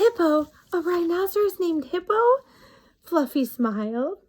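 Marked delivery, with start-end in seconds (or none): 0.0-2.2 amused disbelief. A narrator's reading. Fluffy amazed, laughing.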